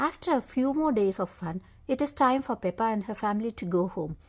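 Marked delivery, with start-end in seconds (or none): none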